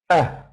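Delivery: "Eh" as a quick isolated vowel; short eh sound. Shown as a demonstration. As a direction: say "Eh" very short on its own. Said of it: "Short eh sound".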